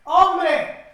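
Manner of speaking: loud, high cry of alarm to someone out on a river; acted warning shout. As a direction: call out urgently from the shore. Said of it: "gritándole".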